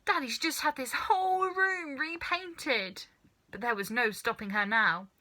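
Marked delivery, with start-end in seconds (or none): 0.1-3.1 put-on high boyish fretting; boy fretting about his father's room. A narrator's reading. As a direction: worry about the paint job.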